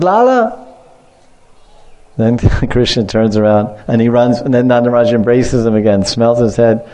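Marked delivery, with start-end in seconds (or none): 0.0-0.6 put-on high-pitched call after a child; father calling his son back; acted calling out. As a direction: call out to your darling boy, pitch leaping up.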